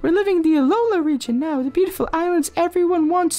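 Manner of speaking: high, bubbly mother voice; cheery game mom. Acted. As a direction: pump the kid up as mom. Reads the game mother's dialogue.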